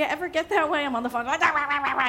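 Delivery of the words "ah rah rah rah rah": mock pterodactyl squawk rah rah; pterodactyl screech. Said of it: "I turn into that pterodactyl".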